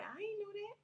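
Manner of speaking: high-pitched, taken-aback reply; high, puzzled disbelief; retold surprise. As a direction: act out being caught off guard, voice way up. Retold surprise at relative's news.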